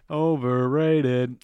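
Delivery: slow, stretched overrated; drawn-out verdict. Acted. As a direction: drag the word out for effect.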